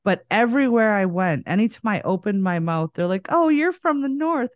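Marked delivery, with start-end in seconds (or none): 3.3-4.5 high-pitched mock Southern sweetness; retold Southern accent, higher voice; imitated Southern dialect. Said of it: "or whatever the dialect was".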